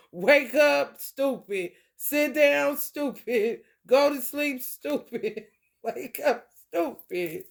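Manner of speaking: loud, high mock orders; high mocking wake up stupid; mocking commands. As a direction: bark it out like scolding a fool. Bossing someone around, mocking.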